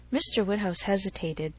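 usual speaking voice

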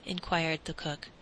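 ordinary delivery, nothing marked

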